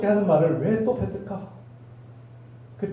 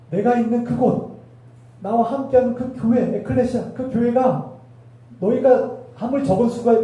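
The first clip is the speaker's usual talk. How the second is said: raised fervent preaching; 6 semitones higher than usual. Acted. Preacher voicing Jesus' promise.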